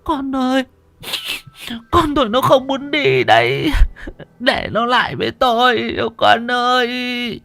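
very high, drawn-out sobbing wail; keening, with long-dragged cries; wailing in grief. Acted. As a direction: cry out as a bereaved mother. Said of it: "bà Tâm thì gào khóc".

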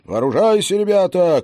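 high, loud call to arms; rallying shout. Acted. Commander to hussars, a rallying shout. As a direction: rouse the men to arm. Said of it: "кричит командир".

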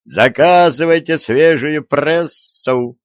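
high, drawn-out newsboy's call. A narrator's reading. Young man fleeing upstairs advertises his paper, calling out. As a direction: stretch it out like a street seller's cry. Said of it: "крикнул он".

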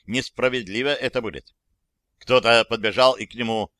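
ordinary delivery

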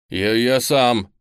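insistent refusal. A narrator's reading. Lyonya refusing the teacher's first aid, loud and insistent. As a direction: brush off help, drawing the words out.

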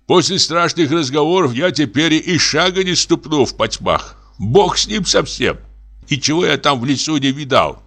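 high-pitched, agitated excuse; frightened refusal. A narrator's reading. Forester refuses to step into the dark, frightened. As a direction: protest high, begging off.

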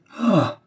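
high-pitched cry of shock; short, high outcry. A narrator's reading. Marquis hearing his wife called unfaithful, cry. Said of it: "Le marquis poussa un cri".

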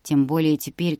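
ordinary delivery

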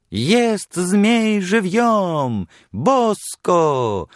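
high, slow, booming fairground call; loud carnival barker. Retold or imitated. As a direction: drum up a crowd for the sideshow. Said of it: "подражая крику ярмарочного крикуна".

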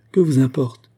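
ordinary delivery, nothing marked

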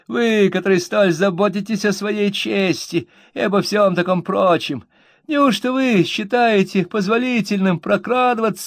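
high, loud, whining sneer; rough whining voice. A narrator's reading. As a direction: whine it out rudely, accusing. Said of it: "грубым и вместе с тем каким-то хнычущим тоном".

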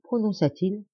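usual speaking voice

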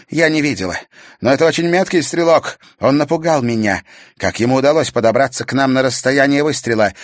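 high, loud and fast; rattled report to her master; anxious young witch. A narrator's reading. (испуганная ведьма)

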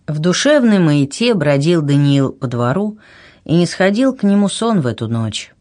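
ordinary delivery

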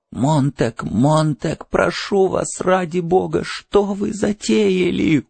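high, slowing entreaty; anxious drawn-out begging; pleading. A narrator's reading. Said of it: "умолял Фабер".

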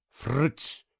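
soft "frrt" with rising pitch; paper-tearing noise. A narrator's reading. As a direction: imitate a sheet being ripped out. Narrator imitates pages being torn out. (звук вырываемого листа)

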